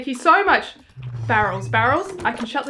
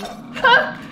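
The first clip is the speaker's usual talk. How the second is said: scared horror game streamer; 10 semitones higher than usual. Real, not acted.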